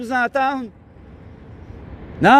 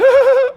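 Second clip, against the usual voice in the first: high loud burst of laughter; 13 semitones higher, 6 dB louder than usual. Real, not acted. Mocking the weed smoker, a loud high laugh.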